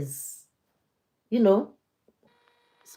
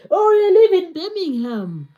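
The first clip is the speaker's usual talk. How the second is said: much higher, loud excited exclamation; thrilled to hear a viewer's city; 9 semitones higher, 11 dB louder than usual. Real, not acted. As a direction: burst out with delight.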